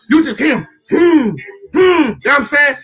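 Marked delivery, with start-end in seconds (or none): 0.9-1.4 short, forceful lion hums; imitated lion rumble. Speaker imitating a wild lion, forceful rumbling hums. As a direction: hum like a lion, in separate bursts. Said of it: "listen to that vibrational tone".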